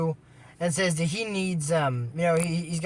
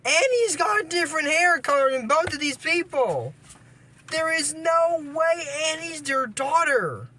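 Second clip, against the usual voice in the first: incredulous; 9 semitones higher than usual. Real, not acted. Incredulous rant about hair colour.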